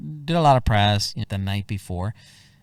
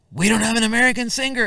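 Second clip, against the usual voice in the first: hurried organizer; 12 semitones higher, 6 dB louder, faster than usual. Retold or imitated. Organizer announces no singer.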